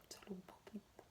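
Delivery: breathy hushed whisper, almost nothing. Real, not acted. Teacher to listeners, a tender hushed whisper. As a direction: let it slip out as a whispered secret. Soft aside after talk of laughter.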